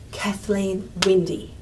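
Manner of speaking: slow Kathleen Windy, heaviest on "Win"; main stress on WINDY. Shown as a demonstration. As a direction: let Windy carry the phrase after Kathleen. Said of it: "Windy is getting the main sentence stress".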